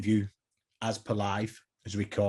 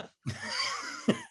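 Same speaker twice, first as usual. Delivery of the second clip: high breathy giggle; 5 dB quieter than usual. Real, not acted. Laughing before the ten questions.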